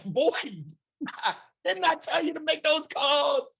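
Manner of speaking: high, laughing mock scold from the wife. Wife down the hallway scolding him: high laughing mock scold. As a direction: play the nagging wife with a laugh. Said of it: "She's like".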